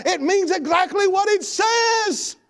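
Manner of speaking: high, forceful pulpit shout; preaching at full force. Acted. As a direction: drive each point home to the congregation.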